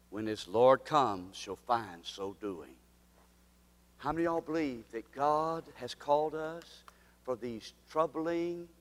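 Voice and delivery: a quiet, earnest pastoral close; soft closing appeal. Acted. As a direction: draw the listeners in gently.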